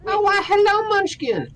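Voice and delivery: high cooing hello; squeaky greeting to a pet. Real, not acted.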